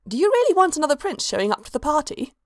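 a brisk scholar raising a point; lightly voiced older woman.

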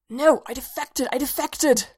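panicked insistence, talking in sleep; hissed in a nightmare. A narrator's reading. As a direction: plead frantically in the dream. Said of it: "he hissed".

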